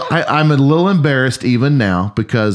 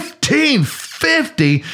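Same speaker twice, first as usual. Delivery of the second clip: stunned, slow and higher; retelling his own outraged shout at the cashier; shocked disbelief; 12 semitones higher, much slower than usual. Retold or imitated. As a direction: stretch out the price like you can't believe it. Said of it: "I said".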